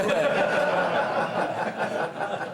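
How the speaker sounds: loud breathy laughing fit; real helpless laughter; burst of laughter. Real, not acted. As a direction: crack up at your own punchline. Big laugh at own story.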